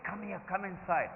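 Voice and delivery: soft, hushed coaxing; cajoling. Retold or imitated. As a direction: gently coax someone to come back in. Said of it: "He was trying to cajole me inside".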